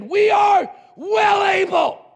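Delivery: high, slow, loud shout; bold declaration. Acted. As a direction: shout it out as a rallying cry. Acts out Joshua and Caleb's declaration.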